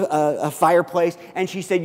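ordinary delivery

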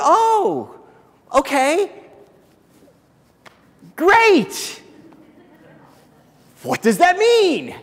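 high, loud incredulous oh okay, great; squeaky shocked boyfriend; stunned surprise. Retold or imitated. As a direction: caught off guard, half laughing in disbelief. His stunned reaction to the addition.

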